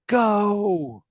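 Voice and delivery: high, loud goal cheer; hammed-up crowd roaring goal; crowd shout. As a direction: shout it like a whole stadium.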